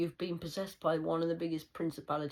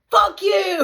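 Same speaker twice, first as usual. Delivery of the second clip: much higher, much louder yelled insult; retold shout; 18 dB louder than usual. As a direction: bellow it after them as they ride off. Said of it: "and then went".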